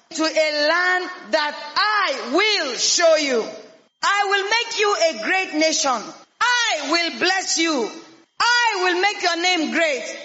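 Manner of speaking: slow, drawn-out proclamation of the divine promise; solemn God's voice. Acted. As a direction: lay each promise down slowly like God speaking. Preacher voicing God's promise.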